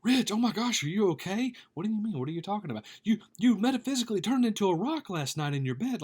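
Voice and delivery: high, loud, hammed-up panic; mock freak-out. Pastor mimics listener panicking over his sleep, high mock alarm. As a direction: squeal it like a frantic friend. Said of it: "start freaking out and saying".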